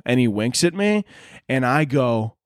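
ordinary talk

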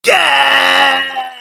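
high, loud whoop of delight; excited shout. Real, not acted. Host cheers the guest's wrestling promo with a loud yell. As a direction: yell it out with joy.

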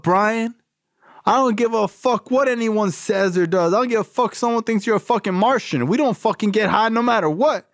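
tough sponsor voice. Retold or imitated. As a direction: bark out the tough-love lecture. Voicing the Russian sponsor.

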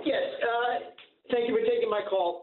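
distant, hollow speakerphone sound. Real, not acted. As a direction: caller talking on speakerphone. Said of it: "you're on speaker".

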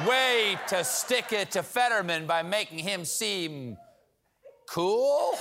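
slow, rising sarcastic praise. Acted. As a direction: drip sarcasm, pitch climbing high. Sarcastic jab at Oz.

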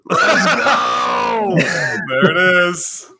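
loud, high cheer, drawn out; excited shout. Real, not acted. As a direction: celebrate it at full voice. Cheering the first sip.

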